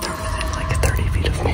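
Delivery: urgent hushed whisper. Real, not acted. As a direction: whisper so they can't hear. Hiding, hunters close.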